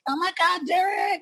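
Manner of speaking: very high-pitched mock fangirl cry.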